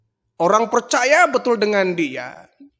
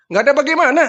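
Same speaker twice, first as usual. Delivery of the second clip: high sharp question; can't believe it, demand why; indignant disbelief; 8 semitones higher, 5 dB louder, much faster than usual. Retold or imitated. Villager questioning the grinning boy, indignant.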